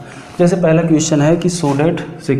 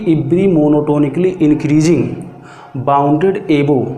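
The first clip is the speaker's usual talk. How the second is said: slow dictation; much slower than usual. Shown as a demonstration. Teacher dictates theorem slowly.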